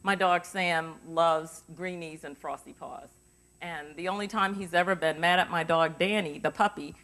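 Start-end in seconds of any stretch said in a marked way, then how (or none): 0.0-3.1 slow, easy everyday sentence; just say something ordinary, slowly; normal tone mic check. Shown as a demonstration. Teacher demonstrating a natural mic check, slow and easy. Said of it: "speak in your normal tone of voice".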